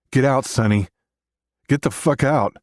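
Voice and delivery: high, loud, hoarse attempt at yelling; strained yell. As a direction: try to yell, voice giving out. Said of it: "I try to yell".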